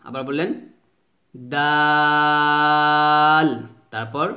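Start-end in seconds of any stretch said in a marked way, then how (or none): 1.5-3.5 দাল with a light D and long, level "aa"; long-drawn, thin দাল; thin soft D. Shown as a demonstration. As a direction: stretch দাল out evenly. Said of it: "তা, দাল পাতলা করে উচ্চারণ করতে হবে".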